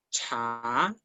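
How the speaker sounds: low "cha", Mandarin ch with air; aspirated Mandarin ch. Shown as a demonstration. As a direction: breathe out hard on the start of "cha". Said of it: "con la C sí expulsamos aire".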